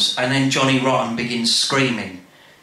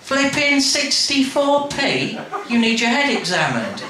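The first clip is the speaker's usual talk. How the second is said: outraged Nan; 12 semitones higher than usual. Acted. Nan scolds her nephew over the price, high and laughing. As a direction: squeal it in laughing disbelief. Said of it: "Nan's verdict".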